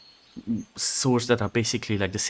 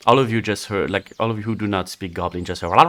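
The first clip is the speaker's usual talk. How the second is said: normal talking voice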